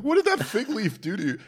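high, loud amused protest; laughing disbelief. Real, not acted. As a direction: ask it through laughter, incredulous. Laughing mock protest at Jesus's curse.

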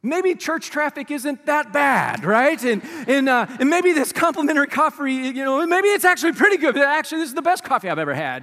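suddenly loving everything; joking over-the-top appreciation; mock gratitude.

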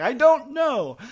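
high, loud comic helplessness; mock exasperation. Improviser admitting he lost track of accents, exasperated. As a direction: throw it out loudly, laughing through the bewilderment. Acting out flustered performer's reply.

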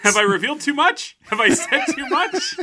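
high, loud mock panic; loud, rising, laughing faux embarrassment; mock-alarmed confession. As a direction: pretend you overshared, voice shooting up.

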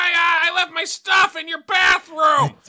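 shrieking squealing hog voice, very high and slow; hog shout. Acted. Hog bursting into the podcast, shrieking pig voice. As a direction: scream it like a frantic pig.

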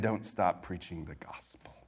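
hushed, intimate whisper. Real, not acted. Pastor pressing the point home to the congregation in a whisper. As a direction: lean in and whisper it.

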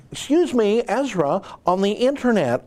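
lightly hammed-up imitation of a prim British presenter; mocking the BBC host in a British accent. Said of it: "I'm doing an accent".

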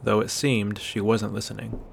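ordinary delivery, nothing marked

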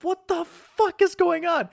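hammed-up shocked exclamation; shocked what the fuck. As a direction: yelp it in sudden panic. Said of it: "I'm like".

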